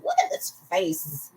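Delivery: very high, squeaky playful gush; tiny high-pitched gushing voice. Pretend. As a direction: coo it way up high. Gushing over her own lovestruck face.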